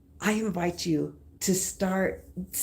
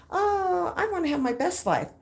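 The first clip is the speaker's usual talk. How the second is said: mock hopeful; 12 semitones higher than usual. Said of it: "So I started saying".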